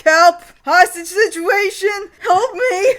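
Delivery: hammed-up cry for help; mock panic. Friend texting for rescue, mock panicked cry. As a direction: yelp it like a mock hostage. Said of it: "I have to text you to be like".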